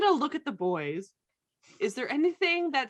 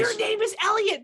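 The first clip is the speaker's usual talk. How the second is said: excited; 11 semitones higher, 6 dB louder than usual. Pretend. Excited realization in game.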